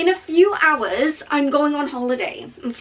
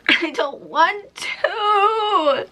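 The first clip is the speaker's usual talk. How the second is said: high, slow, half-laughing whine; mock whine; 7 semitones higher, much slower than usual. To her own tough-love pep talk, a mock whine. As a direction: drag it out like a sulky child.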